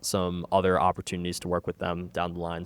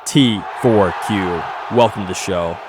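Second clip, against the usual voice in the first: EA voice; 7 dB louder than usual. Acted. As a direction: punch it out like the EA intro voice. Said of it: "Did you like my, my E A voice?".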